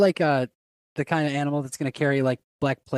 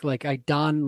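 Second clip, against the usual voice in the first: normal talking voice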